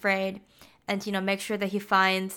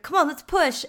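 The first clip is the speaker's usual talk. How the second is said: higher-pitched retold urging; mild mock yell; 7 semitones higher than usual. Soldier rallying men to push the car, mild mock yell. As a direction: lightly imitate rallying the others to help. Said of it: "he yells for everyone to get up".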